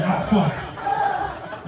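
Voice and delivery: startled. Retold or imitated. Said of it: "And I was like".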